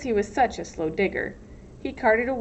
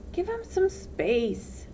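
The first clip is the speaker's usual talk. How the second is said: put-on high, slow boy voice; 10 semitones higher, much slower than usual. A narrator's reading. X-ray defends Stanley.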